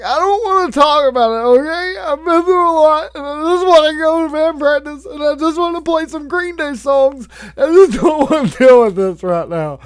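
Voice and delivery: put-on exasperated teen whine. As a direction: wail it like a mortified teenager. Humiliated teen refuses to discuss.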